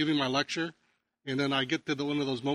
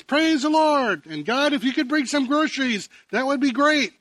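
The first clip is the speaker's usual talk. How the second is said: loud joyful prayer; 10 semitones higher, 6 dB louder than usual. Acted. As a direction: cry it out to God from the porch. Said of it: "So she stood out on her porch and says".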